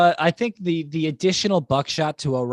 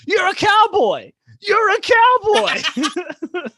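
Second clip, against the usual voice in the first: very high, slow, louder mock voice breaking into a laugh; imitating Bobby Fish; 6 dB louder, much slower than usual. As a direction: drag out the taunt like a cartoon heckler, laughing. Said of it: "And Bobby Fish just goes".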